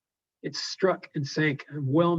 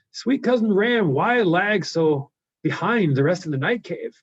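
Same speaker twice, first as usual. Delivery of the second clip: higher, louder coaxing giant; a blind giant sweet-talking his ram; Cyclops voice; 5 semitones higher, 7 dB louder than usual. Acted. Blind Cyclops coaxing his ram, higher and louder. Teacher reads Cyclops aloud.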